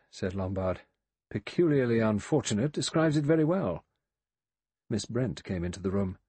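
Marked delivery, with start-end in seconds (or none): 1.3-3.8 sardonic echo, higher and a bit louder, weight on very; mock the phrase back with dry irony; dry ironic echo. A narrator's reading. Lombard wryly echoing the butler's phrase, sardonic.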